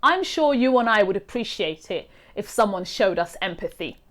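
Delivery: usual speaking voice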